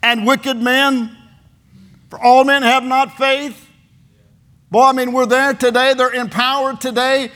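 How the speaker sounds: high, loud, impassioned sermon delivery; full-force preaching. Real, not acted. Preacher praying for the church's protection, full-force preaching. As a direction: cry it out to God and congregation.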